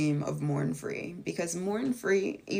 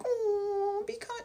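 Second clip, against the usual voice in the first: mock sweet voice; much slower than usual. Mocking people who sugarcoat hate, high and sweet. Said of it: "I'm not gonna be like".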